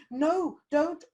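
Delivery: high, loud mock protest; mock frightened plea. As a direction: play a scared victim pushing back. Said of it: "people going".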